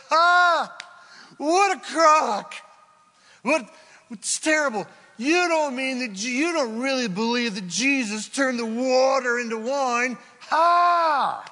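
high, loud mocking laugh and jeer; loud mock scorn from a barroom skeptic; scoffing drinker. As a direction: ham up a sneering old drinking buddy. Scoffing drinker voiced in story.

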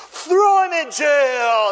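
high, loud, stretched theatrical shout; merciless order. Acted. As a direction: bellow the sentence like a stage villain. Acting merciless servant's sentence.

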